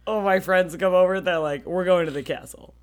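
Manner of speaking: normal talking voice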